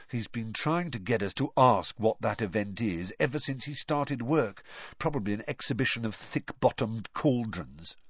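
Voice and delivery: ordinary delivery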